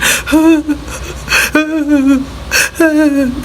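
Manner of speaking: high, drawn-out mock sobs; imitated hard crying. Showing how she cried with the officer, imitated sobbing. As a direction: act out wailing in repeated bursts. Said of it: "I'm sitting there, I'm crying hard".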